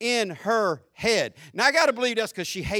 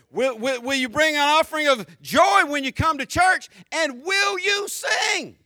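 higher, building preacher's challenge; full-force preaching; 9 semitones higher, 5 dB louder than usual. Real, not acted. Preacher challenging the congregation to sing, full-force preaching.

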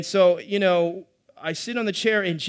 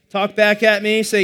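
call out; 2 semitones higher, 8 dB louder than usual. Real, not acted. Calling the crowd to respond.

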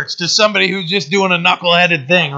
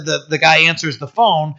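ordinary talk

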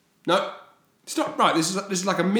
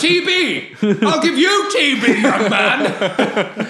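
much higher, louder, laughing mock codger shouting slowly; a hammed-up old man bellowing at a whippersnapper; cranky old-timer; 11 semitones higher, 7 dB louder, much slower than usual. Mock old-timer scolding a youngster.

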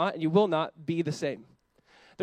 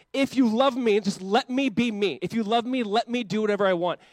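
higher, louder whiny demand; mock entitled demand; 4 semitones higher, 5 dB louder than usual. Mocking the social media mantra, loud and whiny. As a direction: sneer the slogan like a spoiled kid.

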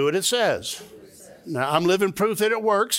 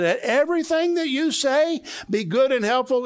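mock irritated whine; 9 semitones higher than usual. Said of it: "I really got irritated when Paul wrote this".

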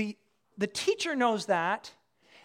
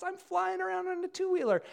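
put-on high, showing-off kid on a bike; gloating child; 7 semitones higher, 3 dB quieter than usual. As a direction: crow like a kid showing off, pitched up. Voices gloating kid on new bike.

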